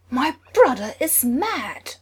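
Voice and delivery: loud, slow angry shout. Acted. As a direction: roar it at her. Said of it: "Thor shouted".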